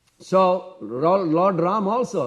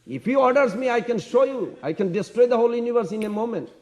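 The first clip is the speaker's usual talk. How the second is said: defiant threat; 7 semitones higher, 3 dB louder, faster than usual. Retold or imitated. Lakshman threatens destruction.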